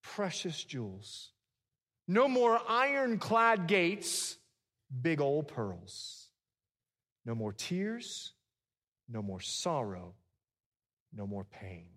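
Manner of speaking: loud, high preaching that falls away; call-and-response preacher rhythm; preaching refrain. Acted. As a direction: thunder the list, then drop to a hush.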